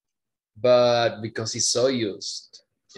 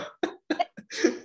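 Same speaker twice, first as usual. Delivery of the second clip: high unvoiced burst of laughter; breathy high laugh. Real, not acted. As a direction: laugh in disbelief.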